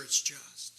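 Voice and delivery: ordinary talk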